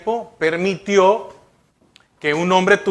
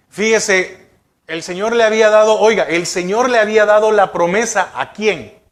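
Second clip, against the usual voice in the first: normal talking voice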